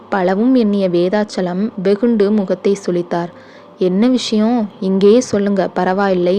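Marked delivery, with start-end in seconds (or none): none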